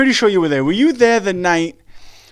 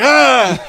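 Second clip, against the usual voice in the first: mock angry yell; 7 semitones higher, 3 dB louder than usual. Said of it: "he's like".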